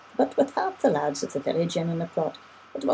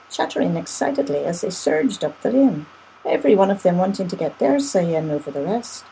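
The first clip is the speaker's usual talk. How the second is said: usual speaking voice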